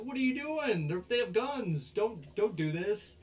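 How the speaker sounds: high, loud retold alarm; mock panic. Onizuka warning the girl about guns, mock panicked. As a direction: plead in exaggerated panic, voice jumping up.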